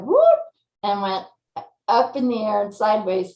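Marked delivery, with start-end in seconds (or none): 0.0-0.4 high, loud mimicked "whoop"; startled horse whoop. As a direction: shoot the pitch way up like a horse spooking. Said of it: "And she was like".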